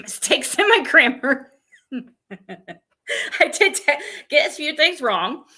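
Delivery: amused, giggly admission; laughing. Real, not acted. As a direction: laugh while owning up to a slip. Amused at own grammar mistakes.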